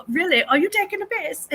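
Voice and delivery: husband's high, teasing British imitation; mock British accent. Husband teasing British people in mock British accent. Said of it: "he's like".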